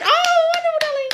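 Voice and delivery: mock-delighted high coo; sing-song gush. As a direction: gush the thanks way up high, then let "darling" fall. Reacting to a viewer comment again.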